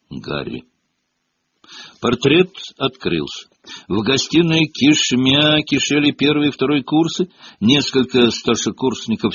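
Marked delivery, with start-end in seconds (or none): none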